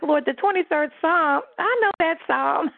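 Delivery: high, confident "I already know that"; breezy self-assured. Retold or imitated. Telling the Lord she knows the Psalm, breezy and confident. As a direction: brush it off like old news. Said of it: "I'm like".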